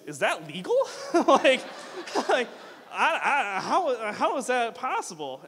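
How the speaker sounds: high, loud, laughing disbelief; shocked disbelief. Retold or imitated. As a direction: gasp out the question in shock. Shocked reaction to teacher news.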